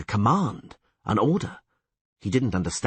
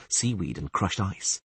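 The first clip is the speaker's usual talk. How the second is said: plain reading voice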